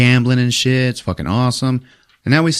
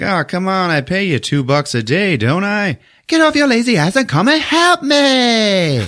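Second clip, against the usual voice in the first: hammed-up captain; 12 semitones higher than usual. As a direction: ham up the lazy bossy captain. Captain voiced in retold movie.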